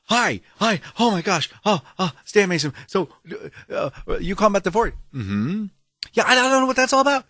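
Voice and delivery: flustered excitement. Retold or imitated. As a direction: gush nervously into the phone. Retells flustered greeting to DMV.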